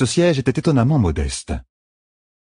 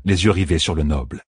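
plain reading voice

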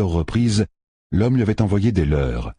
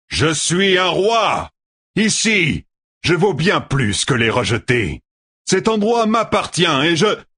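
shouting over the storm; 12 semitones higher than usual. Acted. Tueur proclaims himself king over the gale, shouting high. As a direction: bellow it like a king over wind. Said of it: "couvrant la tempête".

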